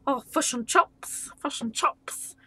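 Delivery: hammed-up Kiwi accent; New Zealand accent. Finding the accent before reading, mock New Zealand voice. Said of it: "gotta go down into the New Zealand".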